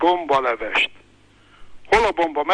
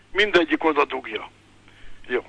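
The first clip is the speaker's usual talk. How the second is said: ordinary delivery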